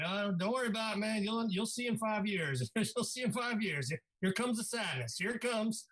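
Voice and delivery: put-on lightly doing his old friend's Southern accent, fond. Retelling how Vic brushed off comics, a mild mock Southern accent. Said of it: "with that accent".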